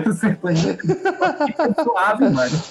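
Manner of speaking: words squeezed out through laughter; laughing. Real, not acted. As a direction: laugh while saying it. Laughing through own joke.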